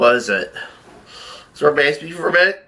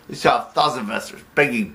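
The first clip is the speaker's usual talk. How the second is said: ordinary talk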